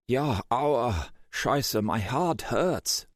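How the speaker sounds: a dazed German hacker, words slurring; German accent, drowsy and slurred. A narrator's reading. Eric speaking, German accent.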